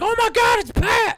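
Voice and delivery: put-on high, loud fan-girl shriek of joy; mock excited shout. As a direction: squeal it like a starstruck fan. Imagined fan spotting him.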